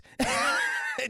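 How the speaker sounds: high burst of laughter; real laugh. Real, not acted. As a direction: burst out laughing at your own joke. Laughs at own joke.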